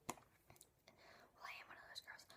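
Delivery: hushed aside, unvoiced; whispered confession. Real, not acted. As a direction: whisper it like a guilty secret. Confessing aside about herself.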